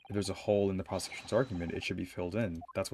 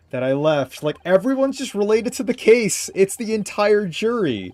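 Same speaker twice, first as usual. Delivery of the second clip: high, loud disbelief; can't believe what you see; incredulous; 12 semitones higher, 11 dB louder than usual. Real, not acted. Laughing shock at the jury.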